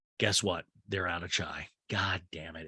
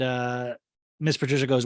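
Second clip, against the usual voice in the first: normal talking voice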